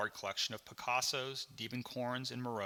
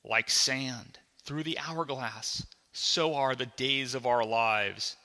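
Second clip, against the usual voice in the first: slow, solemn announcer intonation; soap opera voiceover; slower than usual. Acted. Soap opera opening echoing in a bored boy's brain, slow and portentous. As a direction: intone it like a daytime drama's opening. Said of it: "The opening voiceover of the popular soap opera".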